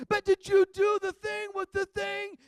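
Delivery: put-on high, slow, eager kid question; excited. Child pestering dad about the ride's best part. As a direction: ask like a wide-eyed child. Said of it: "kid asking excitedly".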